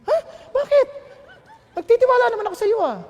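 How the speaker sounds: high, loud startled reply; surprised mother. Retold or imitated. Girlfriend's mother surprised at his request, high and loud. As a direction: jump up in surprise, fast.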